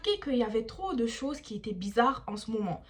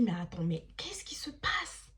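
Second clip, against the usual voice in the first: confused inner whisper; 4 semitones lower, 6 dB quieter than usual. Retold or imitated. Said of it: "je me dis".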